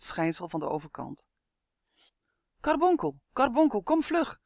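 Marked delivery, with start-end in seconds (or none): 2.6-4.4 high, loud, urgent call; shouting. A narrator's reading. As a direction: shout for someone to come, pitched way up. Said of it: "schreeuwde een tweestemmige bol".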